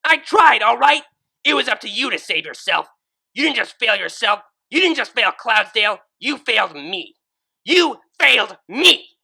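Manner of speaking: high, loud furious rebuke; angry. Acted. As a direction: tear into her with fury. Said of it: "remembering her anger".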